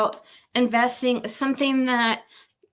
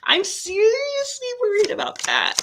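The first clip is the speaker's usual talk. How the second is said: much higher, squeaky seriously worried; high worried whine; 13 semitones higher than usual. Real, not acted. Worried the cards will make her cry, high whine.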